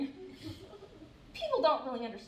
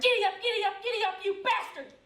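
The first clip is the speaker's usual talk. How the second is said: sudden tic outburst; 8 semitones higher than usual. Acted. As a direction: burst out mid-joke, pitched up. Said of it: "tics just come out of nowhere".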